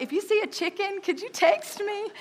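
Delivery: sugary request pitched well up; sweet high asking voice. Retold or imitated.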